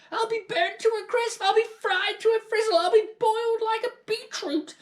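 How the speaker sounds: put-on high, loud frantic old-woman cries; panicked shouting. A narrator's reading. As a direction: raise the alarm in a frenzy. Grandmother wailing about burning.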